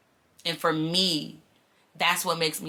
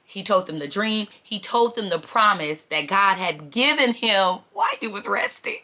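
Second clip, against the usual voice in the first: ordinary delivery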